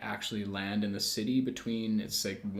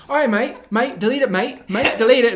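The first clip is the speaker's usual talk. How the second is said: loud, high-pitched mock Aussie command; hammed-up Australian guard, trying to intimidate; mock Australian accent; 11 semitones higher, 12 dB louder than usual. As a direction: do a pushy Aussie "mate" impression. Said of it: "She's talking in an Australian accent".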